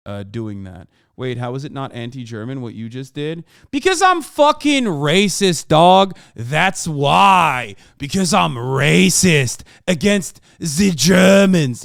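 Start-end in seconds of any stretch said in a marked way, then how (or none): 3.7-11.8 loud, high, drawn-out bellow; over-the-top sarcastic shouting; mock outraged yell. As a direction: yell a sarcastic confession, stretching the words. Sarcastic yelled retort to a chatter.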